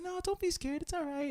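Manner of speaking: high, soft soothing voice; imitated reassurance. Yoga host to a nervous first-timer, imitated reassurance. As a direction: gently coax a nervous beginner, in imitation.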